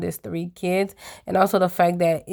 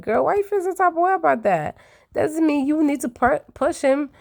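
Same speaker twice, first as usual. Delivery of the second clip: exasperated high voice; 8 semitones higher than usual. Retold or imitated.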